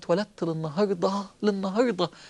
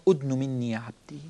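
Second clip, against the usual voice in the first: lower, softer, intimate call; voicing God, low and soft; 9 semitones lower, 6 dB quieter than usual. Preacher voicing God calling the servant near, low and soft. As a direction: draw the servant close gently.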